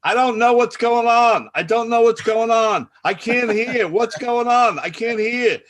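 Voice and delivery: high, loud bewildered yelling; mock hard-of-hearing hollering; confused yelling. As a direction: shout helplessly like a confused elder on a call. Retold confused elderly meeting guest yelling.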